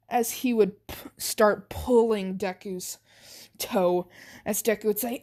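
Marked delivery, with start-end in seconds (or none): none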